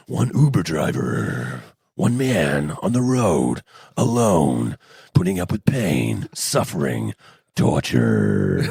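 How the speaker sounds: deep, slow movie trailer narrator, dead serious; American movie trailer guy. Retold or imitated. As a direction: boom out the epic movie trailer intro. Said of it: "the American Movie Guy thing".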